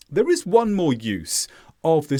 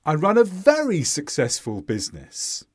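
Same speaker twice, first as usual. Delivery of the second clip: VERY highlighted by going up in tone; stress on VERY. Shown as a demonstration. Teacher showing learners highlighting, tone up on VERY. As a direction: lift VERY above all the other words. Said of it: "emphasized the word very by going up".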